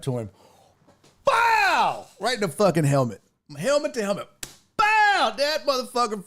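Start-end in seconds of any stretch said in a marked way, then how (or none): 1.2-2.1 loud high "bow!" like a hit landing; cartoon punch sound; punch sound effect. Acting out punching the kid. 4.8-5.3 loud high "bow!" like a hit landing; cartoon punch sound; punch sound effect. Acting out punching the kid.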